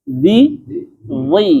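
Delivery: first "Zi" thin, second "zi" high and thick; teacher contrasting sounds; thin then thick zi. Shown as a demonstration. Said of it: "itu yang membedakan ketebalan".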